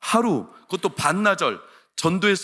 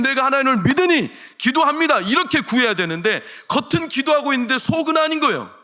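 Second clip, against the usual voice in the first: high, loud sermon delivery; driving, raised pulpit exhortation; impassioned preaching; 7 semitones higher, 5 dB louder than usual. Real, not acted. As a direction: preach it at full force, pitched high and sustained. Preacher builds to full force.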